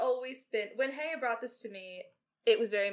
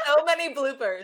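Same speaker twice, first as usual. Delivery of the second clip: laughing; 5 semitones higher, 10 dB louder than usual. Real, not acted. Sharing bloopers laughing together.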